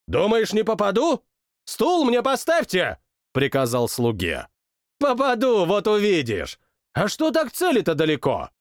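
high, cocky royal bluster; boastful king. Acted. King boasts he will hit, high and cocky. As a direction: brag before the shot.

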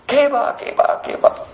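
soft whispered keba, keba; a bird whispering in wonder; whispered bird call. Acted. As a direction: breathe it out as a whisper. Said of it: "Some of the birds are saying".

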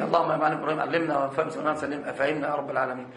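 low, soft, fast murmured supplication; hushed prayer. Real, not acted. Sheikh praying for his students, low and hushed. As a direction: drop into a humble prayer to God.

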